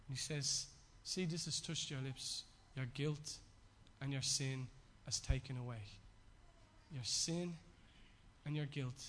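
low, soft voice with long pauses; solemn hushed preaching. Acted. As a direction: preach it gently, leaving silence after each phrase. Solemn, slow-paused sermon close.

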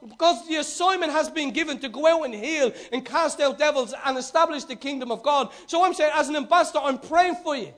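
loud, fervent preaching to the congregation. Acted. Preacher rousing his congregation, in full fervent flow.

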